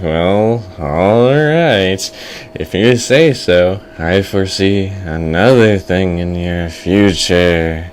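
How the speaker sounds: slow, drawn-out seer voice; old fortune teller. Acted. Fortune teller gives in and predicts, slow old-seer voice. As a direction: intone the prophecy slowly and knowingly. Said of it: "And the old fortune teller is like".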